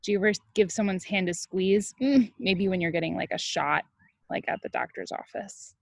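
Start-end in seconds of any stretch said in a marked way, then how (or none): none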